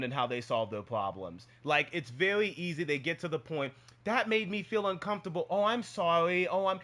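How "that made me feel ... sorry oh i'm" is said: put-on light, higher kid-like voice, quick and simple; kids talking. Mimicking kids who say it and apologise at once, higher voice. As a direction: mimic a child stating a feeling plainly. Kids' quick direct exchange.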